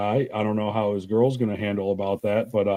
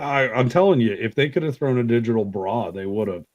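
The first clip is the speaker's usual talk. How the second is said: usual speaking voice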